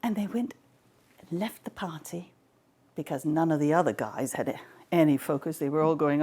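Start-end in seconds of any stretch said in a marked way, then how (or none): none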